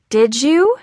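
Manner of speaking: mom's high, loud pointed question; insistent repeat. A narrator's reading. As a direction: she presses for an answer. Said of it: "his mom repeated".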